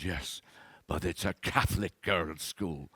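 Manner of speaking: put-on a light Irish accent, mother answering calmly; a gentle Irish lilt on the mother's lines. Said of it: "that's an Irish accent".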